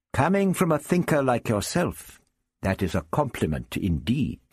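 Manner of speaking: put-on gallant old gentleman, high then settling; the Doctor, courteous. The Doctor bows to his rival Prapillus, graciously. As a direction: return praise with a courtly flourish.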